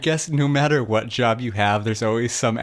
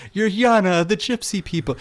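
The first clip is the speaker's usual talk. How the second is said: high, hammed-up grand line; character grandly announces Yana's name, pitched up; mock formal dialogue; 11 semitones higher, 3 dB louder than usual. Mocking dialogue.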